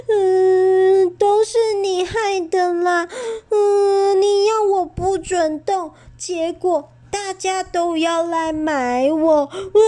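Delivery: high, loud sobbing complaint; crying. Acted. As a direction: wail it through tears. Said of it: "伤心地哭了起来".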